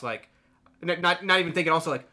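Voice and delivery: ordinary talk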